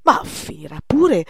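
put-on young painter loses patience, loud exasperated exclamation. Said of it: "esclamò Poussin".

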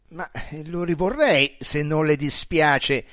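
a bit annoyed. A narrator's reading. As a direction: firmly ask for it back. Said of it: "dissi un po' seccato".

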